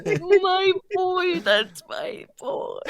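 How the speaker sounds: slow, drawn-out proud call; a proud dad showing off his boy; proud parent. Pretend. Player playfully claims the returned companion, slow and proud.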